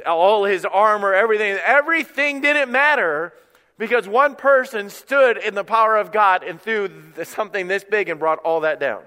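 high, loud sermon climax; full-force preaching. Real, not acted. Preacher tells how Goliath's armor failed, preaching at full force.

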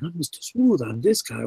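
lightly voiced smooth guy; a hint of a suave charmer; Mister Smooth. Shown as a demonstration. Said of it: "he'll be another guy saying".

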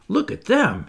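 high exclamation; crying out. A narrator's reading. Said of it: "he cried".

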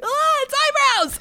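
high, slow teary little cry; fake-weep it through a laugh; mock crying over eyebrows; mock teary exclamation. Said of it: "'cause I'm like".

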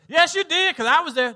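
loud, high-pitched retold objection; mock indignant yell. Family member contradicting his apology, mock indignant yell. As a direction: burst in loudly, imitating an accuser.

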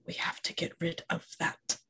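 hushed whisper in Elvish. Acted. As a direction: breathe it out secretly, barely voiced. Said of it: "say in Elven very quietly".

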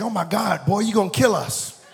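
hammed-up alarmed outcry; mock alarm. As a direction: exclaim like a panicked passenger, played for laughs. Imitating alarmed passenger preacher.

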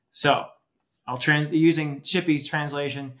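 ordinary talk

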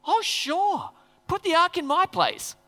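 high, bright eager agreement; cheery Obed-Edom. Retold or imitated. Obed-Edom cheerfully offering his house for the ark, high eager voice. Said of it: "pretty faithful guy".

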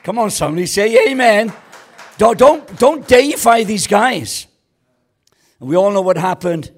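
loud, high rousing call; preaching at full force. Acted. Preacher calling the crowd to say amen. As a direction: whip the room up.

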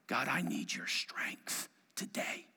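soft, slow breathy whisper; whispered prayer. Shown as a demonstration. Worker at work quietly asking God for strength, whispered. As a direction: pray it under your breath. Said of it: "whispered prayer demo".

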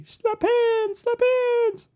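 high, squeaky goofy-character voice; Waterboy voice. Acted. Showing cohost how slap hands should go, in Waterboy voice. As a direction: put on a squeaky Waterboy impression. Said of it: "like, the Waterboy voice".

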